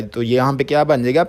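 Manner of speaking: ordinary delivery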